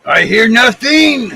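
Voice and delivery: slow, loud, put-on voice as Larry; Larry's voice. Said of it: "Did you hear that, Larry?".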